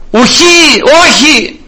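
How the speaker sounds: high, drawn-out exasperated no; disappointed, indignant. Retold or imitated. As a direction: act out a fed-up refusal. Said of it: "απογοήτευση και αγανάκτηση μαζί στο όχι αυτό". (απογοητευμένο, αγανακτισμένο όχι)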